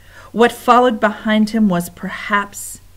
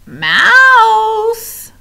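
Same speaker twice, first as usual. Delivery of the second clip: high, loud singsong squeal; shrill gleeful rat cry; joyful rat call; 10 dB louder than usual. Acted. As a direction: sing out the find with glee. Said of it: "sang out one rat joyfully".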